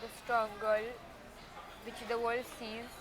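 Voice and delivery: real emotion tugging at the voice, holding it together; thanking her family, close to tears; emotional. Real, not acted. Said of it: "I'm very emotional".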